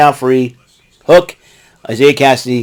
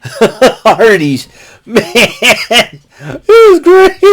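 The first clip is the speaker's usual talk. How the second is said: laughing; 8 semitones higher, much slower than usual. Real, not acted. Laughing recap.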